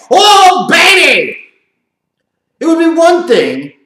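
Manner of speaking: high, loud sarcastic whoop; mock hype. Commentator mocking the quarterback battle, a sarcastic loud whoop. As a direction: fake excitement.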